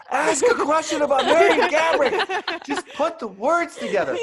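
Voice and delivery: laughing mock inner scream at himself while freezing up. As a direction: play the panic in your head while laughing at yourself. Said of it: "I'm screaming in my head".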